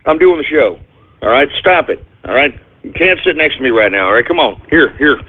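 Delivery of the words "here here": usual speaking voice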